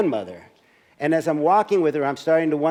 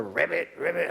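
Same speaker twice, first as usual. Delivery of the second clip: a soft, playful croak of ribbit, ribbit; mock frog voice said softly; 3 semitones higher, 5 dB quieter than usual. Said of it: "grandchildren's first words were".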